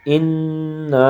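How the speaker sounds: انا with the nasal ن held long; انا drawn out with a long nasal ن; nasal ghunnah. Shown as a demonstration. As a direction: stretch the ن in انا through the nose, very slowly. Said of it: "اس کو ایک الف لمبا کرتے ہیں".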